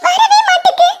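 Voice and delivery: put-on high child's voice, wailing in distress; a small boy near tears, calling out; panicky little boy. Boy Ringu wails over his stuck shovel, high and tearful.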